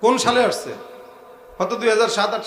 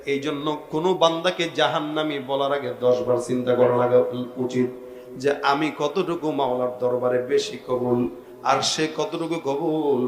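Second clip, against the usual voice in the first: melodic admonition; 7 semitones lower than usual. Real, not acted. Preacher counsels against judging other servants, melodic admonition. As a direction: counsel them in a lowered preaching chant.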